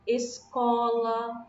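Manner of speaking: "Escola" drawn out slowly on CO; stress on penultimate syllable. Shown as a demonstration. Teacher showing pupils the strong syllable, long stressed CO in "Escola". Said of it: "tá vendo?".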